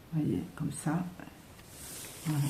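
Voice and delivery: soft, unvoiced whisper; whispered aside. Real, not acted. To viewers, showing the long pile, whispered. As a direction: drop to a whisper while showing it.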